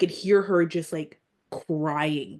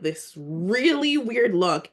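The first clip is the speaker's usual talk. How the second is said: ordinary delivery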